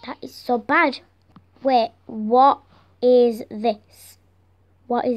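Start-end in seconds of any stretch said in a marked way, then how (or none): none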